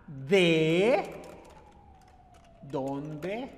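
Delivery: low "de ... dónde ... eres" with long pauses; word-by-word modelling. Shown as a demonstration. Teacher explaining word order to students, word-by-word modelling.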